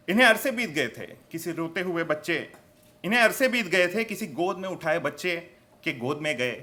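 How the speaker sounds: emphatic storytelling verse, rising then falling; poetry recitation. Acted. Poet pleads for forgotten desi toys, declamatory recitation. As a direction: declaim each line to a live crowd.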